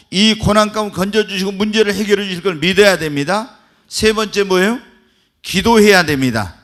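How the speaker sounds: loud, driving list of promises; full-force preaching. Acted. Pastor rallying the congregation to believe, full-force preaching. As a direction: hammer each promise home.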